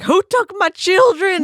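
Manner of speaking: high, loud mock indignation; acted outrage. Imitating a friend reacting to the cutscene, acted outrage. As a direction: demand it loudly, hammed-up.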